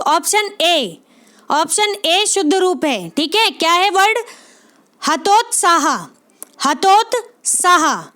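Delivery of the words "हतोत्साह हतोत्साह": हतोत्साह said slowly, syllable by syllable; careful spelling demo. Shown as a demonstration. Teacher slowly sounding out हतोत्साह for students. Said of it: "आधा ता आएगा".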